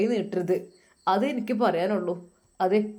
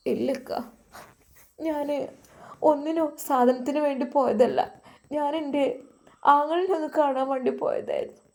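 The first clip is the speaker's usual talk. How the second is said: higher voice of a wife gently defending herself; a wife explaining she only went to see her brother; pleading wife; 7 semitones higher than usual. Acted.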